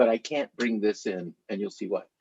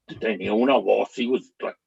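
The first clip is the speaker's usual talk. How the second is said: ordinary delivery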